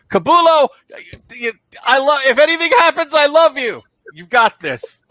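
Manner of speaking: high, loud call; yelling to next room. Acted. The Dralsite yelling to Kabulo in the next room, loud. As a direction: shout it through the wall. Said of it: "he yells to the next room".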